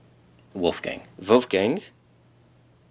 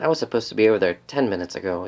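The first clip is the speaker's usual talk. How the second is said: usual speaking voice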